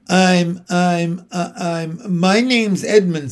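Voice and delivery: hesitant, stammering start to an introduction; awkward. A narrator's reading. Edmund answering the staring lady, awkward. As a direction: stumble over your words, then get your name out. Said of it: "said Edmund rather awkwardly".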